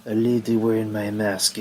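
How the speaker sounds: mock Japanese accent, lightly put on; a clumsy Japanese-accented Asuka, puzzled; a hint of a Japanese accent, half-hearted; bad Japanese accent. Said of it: "bad Japanese accent".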